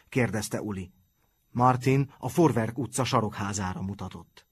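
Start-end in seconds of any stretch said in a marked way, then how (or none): none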